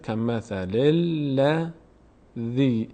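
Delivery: کمثل الذی very slowly, leaning on the double l; slow teaching recitation with lengthened lam; demonstrated doubled lam. Shown as a demonstration. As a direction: join kamathalil to ladhi syllable by syllable. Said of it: "یک بار اینجوری میاد".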